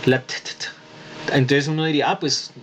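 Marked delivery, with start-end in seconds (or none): 0.0-1.3 slow voiceless "t, t, t" taps; t sound demo. Shown as a demonstration. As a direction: tap out "t" without voice. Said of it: "Aquí termina en T". ("t, t, t" lento y sin voz)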